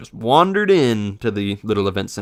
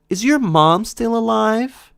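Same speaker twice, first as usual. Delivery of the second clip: put-on high, slow, innocent kid voice; imitating a kid; 11 semitones higher, slower than usual. Kid asking the narrator about his mother, high slow childish voice. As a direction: ask it slowly like a blunt little child. Kid's morbid question.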